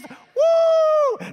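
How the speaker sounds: sudden shouted high woo; explosive whoop. Pretend. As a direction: burst out like a fight erupting. Mimicking a couple's blow-up.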